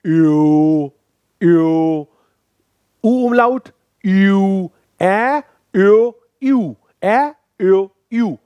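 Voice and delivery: यू said slowly as rounded German ö and ü; umlaut vowel drill. Shown as a demonstration. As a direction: round the lips and stretch each यू. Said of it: "ओ umlaut".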